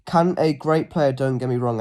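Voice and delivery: normal talking voice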